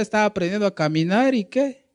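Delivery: normal talking voice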